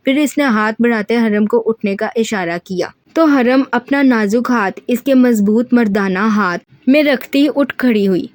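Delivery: plain reading voice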